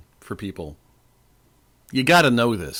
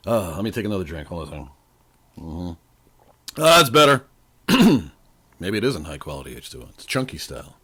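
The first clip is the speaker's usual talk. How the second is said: normal talking voice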